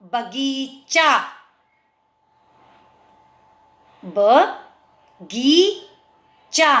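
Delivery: बगीचा stretched very slowly, ब गी चा; careful drawn-out teaching word; slow syllables. Shown as a demonstration. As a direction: draw out बगीचा. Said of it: "च को मैंने आ बोला है".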